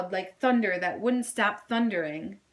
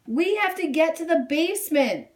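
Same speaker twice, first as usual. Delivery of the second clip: higher, louder urgent order; urgent shout; 7 semitones higher, 5 dB louder than usual. A narrator's reading. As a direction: hurry him to shelter. Sister pulls him inside.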